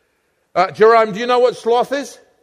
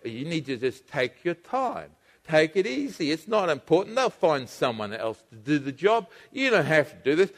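soft tempting voice; 5 semitones lower, 8 dB quieter than usual. Pretend. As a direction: murmur it like a sly tempter. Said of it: "the little voice inside you say".

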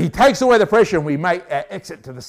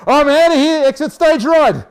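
eager escape; 10 semitones higher, 8 dB louder than usual. Retold or imitated. Fleeing prisoner quoted.

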